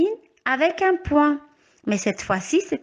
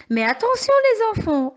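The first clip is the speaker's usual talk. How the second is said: much higher, lively call for attention; playful warning; 9 semitones higher, faster than usual. Real, not acted. Teacher warning the children, much higher and lively. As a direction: pitch it up to grab the class. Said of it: "teacher warns children".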